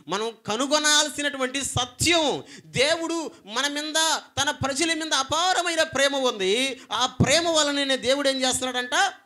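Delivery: loud, high preaching, slowing on దేవుడు మన మీద; preaching at full force. Real, not acted. Preacher declaring God's love, loud and high. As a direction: proclaim the truth with full force.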